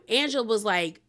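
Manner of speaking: ordinary talk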